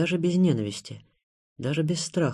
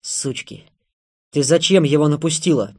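angry outburst, muttered insult then a loud demand; furious questioning, out of breath; breath-caught anger; 5 dB louder than usual. A narrator's reading. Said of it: "У него перехватывало дыхание".

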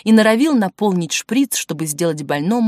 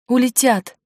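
higher-pitched cry of warning; a short raised cry; crying out in alarm; 5 semitones higher than usual. A narrator's reading. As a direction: call it out, pitched up. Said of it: "закричала Ангелина".

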